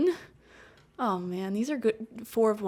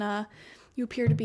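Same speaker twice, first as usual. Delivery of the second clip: normal talking voice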